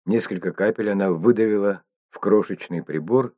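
ordinary delivery

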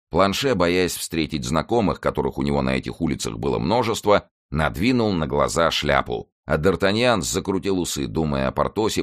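plain reading voice

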